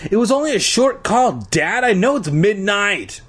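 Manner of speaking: high, protesting call to a parent; exasperated teen calling back. A narrator's reading. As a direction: protest to dad with pitch way up. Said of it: "heard her call to her father".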